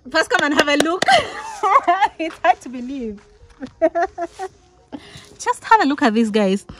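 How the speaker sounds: loud, thrilled showing-off with laughs between; excited. Real, not acted. Shows fans her award plaque.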